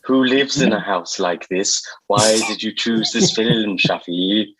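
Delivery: drawn-out presenter impression, odd accent; Lloyd Grossman. Guest questions host about the film choice, mock presenter impression. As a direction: quiz him slowly in a put-on presenter voice. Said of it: "My sort of Lloyd Grossman".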